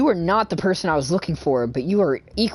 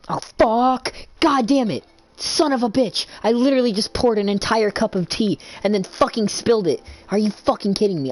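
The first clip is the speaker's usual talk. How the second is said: angry outburst; 5 semitones higher than usual. Real, not acted. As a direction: explode at the spilled tea in real anger. Spilled his tea, real outburst.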